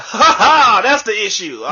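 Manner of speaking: loud, high laughing jab; mock laughing medic. Paramedic laughs at his pre-workout excuse, loud mock laugh.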